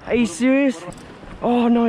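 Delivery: normal talking voice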